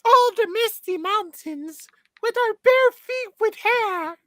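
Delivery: high, squeaky cartoon elf. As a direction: put on a high little elf voice. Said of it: "stop talking like an elf".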